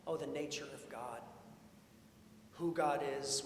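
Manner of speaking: usual speaking voice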